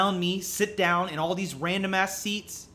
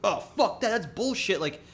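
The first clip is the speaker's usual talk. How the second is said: retold rant; 7 semitones higher, 3 dB louder than usual. Retold passengers ranting.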